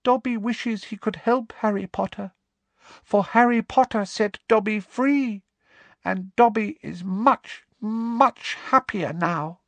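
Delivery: very high squeaky elf, earnest and slowing at the end; serious squeaky house elf; very seriously. A narrator's reading. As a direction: say it gravely like a devoted little elf. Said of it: "he said very seriously".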